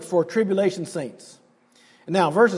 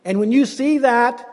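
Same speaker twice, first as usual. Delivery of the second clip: usual speaking voice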